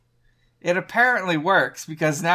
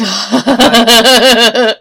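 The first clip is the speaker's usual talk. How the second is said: loud burst of laughter; laughing; 6 semitones higher, 13 dB louder than usual. Real, not acted. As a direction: laugh through the word.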